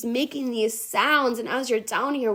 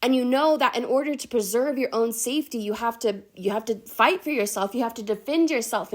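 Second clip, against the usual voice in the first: ordinary talk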